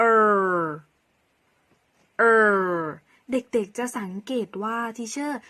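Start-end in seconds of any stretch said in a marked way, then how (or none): 0.1-2.7 "er" held long and loud; letter sound R. Shown as a demonstration. Teacher demonstrates the letter to children, letter sound R. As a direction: sustain "er" as the phonics sound of R. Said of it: "R ให้เสียง".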